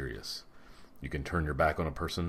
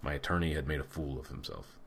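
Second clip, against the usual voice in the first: usual speaking voice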